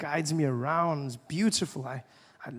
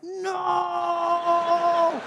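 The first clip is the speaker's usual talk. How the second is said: high, loud panicked scream; panicked shout; 7 dB louder than usual. Retold or imitated. Driver screaming at oncoming car, high loud panic. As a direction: yell it as the car comes at you.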